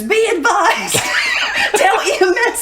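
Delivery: high radio shout breaking into laughter; imitated hollering. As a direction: holler the radio call, cracking up. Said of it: "started hollering".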